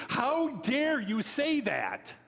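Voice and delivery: hammed-up how-dare-you; mock outrage. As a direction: play the offended critic, drawn out. Imitating indignant online critic.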